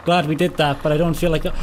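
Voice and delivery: normal talking voice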